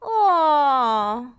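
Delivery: high, loud dismayed wail; crying aw of dismay. A narrator's reading. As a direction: let out a sad little wail. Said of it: "wailed Dizzy".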